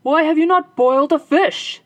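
loud, high furious husband; angry cry. Acted. Husband yelling at his wife over supper, furious. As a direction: bellow it at your wife. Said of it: "he cried".

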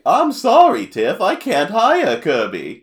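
slow, high, loud cartoon voice; exaggerated drawn-out character impression; Chef Kawasaki. The chef turning Tiff down, loud high impression. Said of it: "he talks like this".

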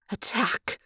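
soft, whispered command; near whisper. A narrator's reading. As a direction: give the order almost in a whisper. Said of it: "Her voice was nearly a whisper".

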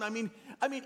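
ordinary talk

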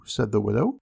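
ordinary delivery

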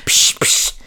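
imitated spray hiss, two bursts; two short voiceless spritzes; spray sound. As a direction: mouth the noise of a spray can.

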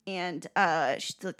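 usual speaking voice

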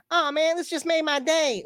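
high, delighted squeaky voice; very high-pitched happy impression. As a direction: impersonate a thrilled rapper way up high. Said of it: "have that, like, high voice".

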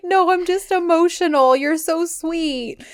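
high, emotional wail, imitating her; mock tearful. Retelling her teary answer to him, mock tearful. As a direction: draw it out high, as if tearing up. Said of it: "tearing up, getting emotional".

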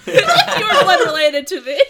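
loud, high, incredulous through laughter; mock outburst. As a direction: blurt it out in mock disbelief.

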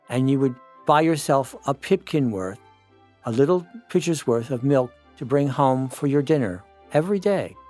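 usual speaking voice

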